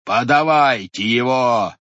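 loud, slow demand; commanding shout. A narrator's reading. Head demanding the captured devil, loud and slow. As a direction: bark for the prisoner. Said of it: "закричал голова".

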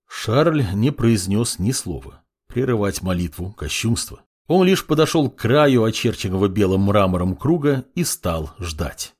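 ordinary delivery, nothing marked

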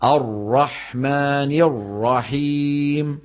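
"الرحمن الرحيم" with lips pushed forward on the ra, slow; rounded-lips ra. Shown as a demonstration. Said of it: "هذا غلط هذا". (ضم الشفتين عند الراء)

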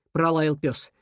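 ordinary delivery, nothing marked

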